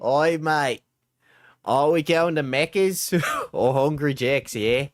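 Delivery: broad Queensland Aussie storytelling; Queensland accent. Pretend. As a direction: spin a yarn in a put-on Queensland accent. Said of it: "it's more of a Queensland accent".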